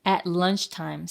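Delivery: plain reading voice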